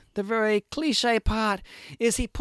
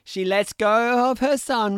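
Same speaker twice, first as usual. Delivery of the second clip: normal talking voice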